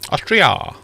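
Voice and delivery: hammed-up Austrian accent attempt. Shown as a demonstration. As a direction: try an Austrian accent, swooping down from high. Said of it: "say Austria in an Austrian accent".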